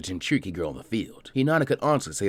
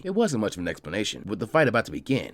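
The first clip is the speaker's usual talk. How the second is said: plain reading voice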